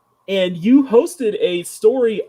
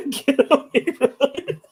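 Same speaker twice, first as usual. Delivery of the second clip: laughing; 9 semitones higher, slower than usual. Real, not acted. Laughing while describing her character.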